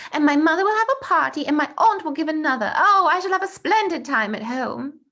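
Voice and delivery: put-on eager young boy Bertie, showing off; eager schoolboy. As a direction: brag about the holidays like an excited schoolboy.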